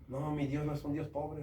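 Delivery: ordinary talk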